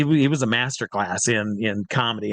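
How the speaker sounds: normal talking voice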